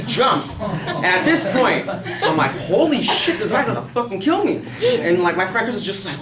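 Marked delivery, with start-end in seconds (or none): 2.5-4.6 higher-pitched, half-laughing panic; retold alarm. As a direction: relive the shock of being in danger. Retold alarm as man jumps down.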